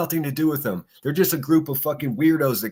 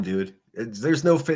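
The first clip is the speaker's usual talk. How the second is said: ordinary talk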